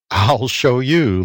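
ordinary delivery, nothing marked

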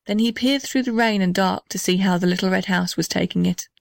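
usual speaking voice

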